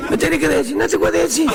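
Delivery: high-pitched mock bickering; exasperated back-and-forth. Two men arguing over a name, high mock bickering.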